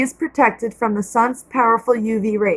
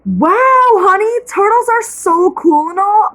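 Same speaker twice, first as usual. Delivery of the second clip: much higher, louder mock wow; mother humoring her daughter, excited; excited mom; 7 semitones higher, 4 dB louder than usual. A narrator's reading. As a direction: play along with the kid's wonder. Mother delighted at turtle.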